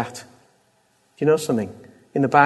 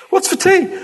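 much higher, mock-cheery husband; oblivious husband; 4 dB louder than usual. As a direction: chirp it like a clueless husband home from work. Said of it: "you're feeling okay".

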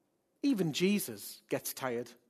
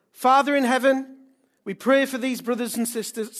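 loud, raised-pitch public prayer; fervent prayer; 8 semitones higher, 9 dB louder than usual. Real, not acted. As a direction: pray out boldly over the congregation. Leads congregation in prayer.

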